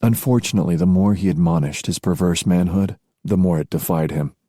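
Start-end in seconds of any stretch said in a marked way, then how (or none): none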